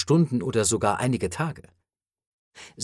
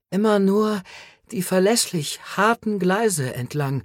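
higher, dazed stammer; 7 semitones higher than usual. A narrator's reading. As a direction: stammer it out half-conscious. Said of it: "stammelte er". (stammelnd, benommen, höher)

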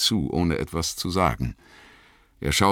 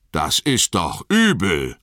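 louder, higher indignant call; crying out; 5 semitones higher, 5 dB louder, slower than usual. A narrator's reading. Sam jumps up at Boromir's account, crying out. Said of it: "rief Sam".

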